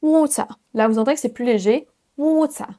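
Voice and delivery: water as waw-tuh in a British accent; light British ending on water. Shown as a demonstration. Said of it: "Et l'accent U K".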